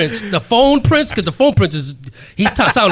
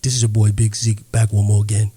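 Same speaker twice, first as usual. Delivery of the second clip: much lower, rumbling hype intro; Big Zeke impression; 10 semitones lower than usual. [name] introducing Big Zeke, low booming intro. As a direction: go way down low for him. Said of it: "I have a deeper voice than that".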